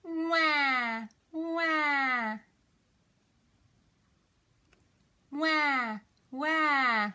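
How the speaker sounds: drawn-out mock baby wail; crying baby. Shown as a demonstration. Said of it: "who's crying?".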